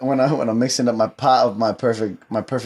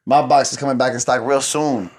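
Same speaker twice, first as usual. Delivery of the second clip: ordinary talk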